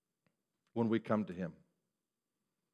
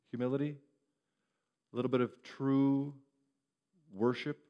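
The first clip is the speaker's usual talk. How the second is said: ordinary talk, nothing marked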